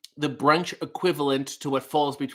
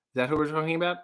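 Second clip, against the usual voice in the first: usual speaking voice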